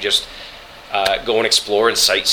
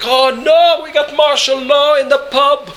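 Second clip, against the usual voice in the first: much higher and louder, thrilled outburst; excited local explodes; 6 dB louder than usual. Retold or imitated. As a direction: burst out like a starstruck fan in a pub. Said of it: "he just explodes".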